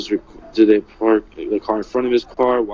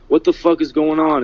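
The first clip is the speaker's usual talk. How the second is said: raised, loud confused cry; retold yell; 5 semitones higher, 7 dB louder than usual. Friend's last words before the shooting, retold as a mild yell. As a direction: yell it out in alarm. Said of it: "So he yelled it".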